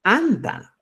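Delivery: ANTA begun with a popped ejective t; explosive t. Shown as a demonstration. Teacher saying Cusco's word for bread, explosive ANTA. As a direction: burst the tongue off the teeth into ANTA. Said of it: "Es explosivo".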